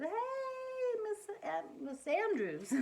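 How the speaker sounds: put-on high, eager little girl calling her teacher; excited preschooler greeting; excited child. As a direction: call out brightly like an excited kid.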